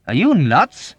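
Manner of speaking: loud, high incredulous shout; exasperated yell. A narrator's reading. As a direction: shout it in disbelief. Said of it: "Yossarian shouted".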